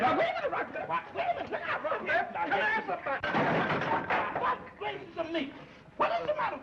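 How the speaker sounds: very high, loud, nervously laughing shriek; frightened man shrieking at a sleepwalking barber; terrified panic. Acted. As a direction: squeal in terror with a nervous laugh.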